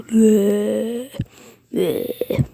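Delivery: imitated moaning and groaning. Hollows sitting there above Deku, imitated moaning and groaning. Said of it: "like, moaning and groaning".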